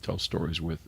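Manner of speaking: normal talking voice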